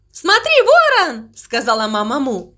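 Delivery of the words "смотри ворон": high, stretched-out call; excited call. Acted. Mama Mu calls the crow to watch, high and excited. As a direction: call the friend up high, gleefully.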